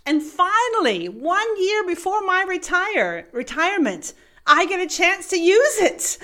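high, eager, bursting delight; jumping for joy. Retold or imitated. Manager before retirement thrilled to use the form, high. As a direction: bounce with excitement. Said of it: "he said, almost hopping, jumping for joy".

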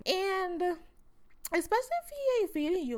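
high, fast and loud; excited high rant. Real, not acted. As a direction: shoot up in pitch, rushing. Rising rant.

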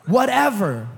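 loud congregational call; call-and-response shout. Acted. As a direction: get them to shout it back. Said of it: "someone say".

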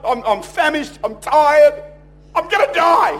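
high, loud overdramatic whine of exhaustion; hammed-up dying of hunger; melodramatic complaint. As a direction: build to a mock desperate cry. Acting out starving Esau's exaggerated complaint.

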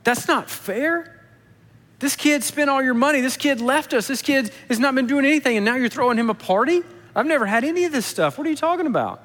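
put-on a wronged older son protesting, voice climbing high; upset, protesting. Older brother protesting to his father, indignant and high. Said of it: "He's mad".

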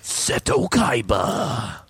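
loud, slow anime cry; hammed-up rival's shout; dramatic name-call. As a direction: bellow the name, stretching it out. Acted anime character name-call.

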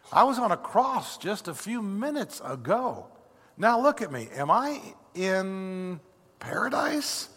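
high, awestruck voice drawing out the last question; dawning amazement, leaping high on the last word; astonished former thief. Acted. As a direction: wonder at finding himself in paradise. Thief realises he is in paradise.

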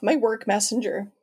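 normal talking voice

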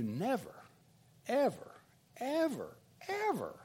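"never, ever, ever, ever" spaced out, pitch climbing; emphatic repetition. Real, not acted. Driving home the lesson.

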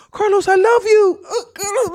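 high, sobbing mock plea; imitating a crying plea. Retelling the neighbor woman crying to Carlos, high sobbing plea. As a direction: wail it high like she's crying mid-fight. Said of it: "all she keeps saying is".

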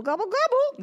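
gobble jumping high on the last one; playful turkey gobble. Pretend. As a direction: pretend to be a turkey. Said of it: "we're all gonna be turkeys".

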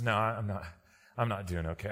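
put-on a beaten-down young man who has given up; mumbled low and soft in despair; defeated. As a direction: voice drops low and soft, worn out. Weary young man asks for help.

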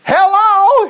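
high, loud sarcastic hello; exaggerated, exasperated shout; harsh retort. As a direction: holler it high and drawn out. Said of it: "spoke harshly to Jonathan".

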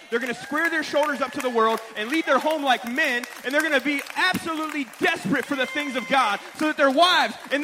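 high, loud, impassioned preacher; full-force preaching. Acted. Father preaching on raising four sons for God, fired-up preaching. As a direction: build it into a rousing charge.

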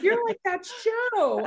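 very high, slow mock amazement; amazed stranger. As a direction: mimic an astonished stranger, drawn out. Astonished stranger imitated.

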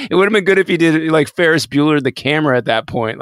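ordinary talk, nothing marked

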